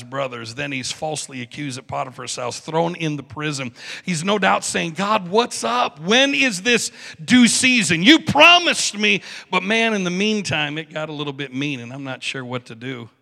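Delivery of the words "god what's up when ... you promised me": slow, high, loud challenge to God; mounting indignation, each word leaned on; exasperated plea. Acted. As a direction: demand an answer, rising to a drawn-out protest. Joseph complaining to God from prison.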